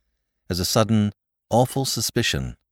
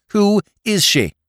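louder, higher, slow insistent demand; 6 dB louder, slower than usual. A narrator's reading. Jimmy demanding name from Drever, loud and insistent. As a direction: press him hard for a name. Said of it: "he kept demanding".

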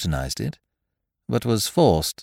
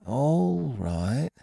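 drawn-out, higher, uneasy assent; slow, hesitant; 7 semitones higher, 3 dB quieter than usual. A narrator's reading. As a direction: agree slowly and reluctantly.

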